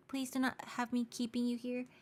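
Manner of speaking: ordinary talk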